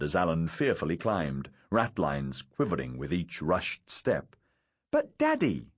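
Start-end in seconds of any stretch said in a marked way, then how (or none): 4.9-5.7 put-on very high child voice; protesting small boy. A narrator's reading. Little son objecting to his father, high protest. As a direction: protest like a four-year-old. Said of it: "Hugh protested".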